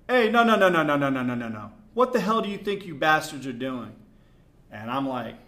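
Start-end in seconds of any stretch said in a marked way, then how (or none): 0.1-3.9 loud rapid burst of no's, high start tumbling down; imitating an angry yell. [name] yelling at strangers messing up the court, angry shout. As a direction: yell it like stopping troublemakers in their tracks.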